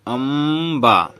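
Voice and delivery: अम्बा slow, humming through the m; drawn-out pronunciation. Shown as a demonstration. Teacher shows the m coming, अम्बा stretched out. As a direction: dwell on the m in अम्बा. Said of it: "मौ को उच्चारण में आ रहा है".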